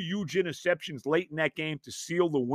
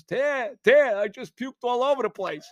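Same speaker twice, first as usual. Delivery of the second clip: higher, a bit louder mock yell calling up; retold yell downstairs; 9 semitones higher, 3 dB louder than usual. Calling his wife from upstairs after being sick, mock yell. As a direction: call out for help, half-hammed. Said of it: "I'm screaming downstairs".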